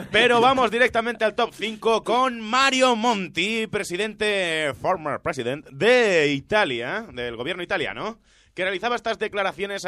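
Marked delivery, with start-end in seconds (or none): 0.1-7.1 lightly hammed-up show announcer; countdown announcer. Host presenting number five to listeners, announcer style. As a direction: stretch out the name like a countdown host.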